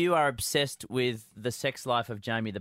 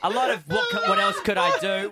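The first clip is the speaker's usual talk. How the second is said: laughing; 7 dB louder than usual. Real, not acted. Laughing while impersonating.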